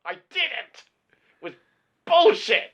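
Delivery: high, loud indignant yell; mock outraged teen shouting No I didn't; angry retort. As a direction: relive being furious at the film. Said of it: "I was really cross".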